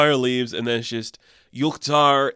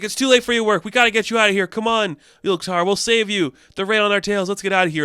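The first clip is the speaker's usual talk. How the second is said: high, pressing plea to flee; rally a friend to escape, lightly acted; retold urgent urging; mock urgency; 7 semitones higher, faster than usual. Friend urges escape under fire.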